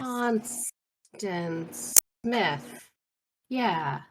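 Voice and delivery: very slow, drawn-out words; distracted. Preoccupied character recalls who they seek, slow and distracted. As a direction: stretch the name out as if the mind is elsewhere. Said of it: "kinda looking up and around".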